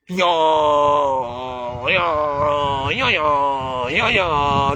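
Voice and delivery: long, low, swooping "yo" sounds; mock drawn-out babble. Vlogger mimicking newcomers calling the home country, mocking drawn-out drone. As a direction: stretch each "yo" low, then swoop it up and down.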